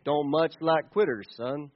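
a hint of the Duke, low and quiet; John Wayne drawl. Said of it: "John Wayne saying".

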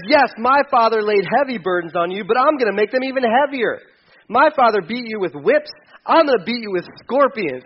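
hammed-up bully king; threatening king. Rehoboam threatening the complaining people, mock menacing.

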